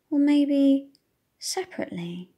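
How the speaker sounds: usual speaking voice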